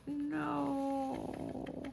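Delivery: long, soft high wail of disappointment; quiet, heartsick mock wail; dismayed. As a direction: let a drawn-out no fade away. My heart sank.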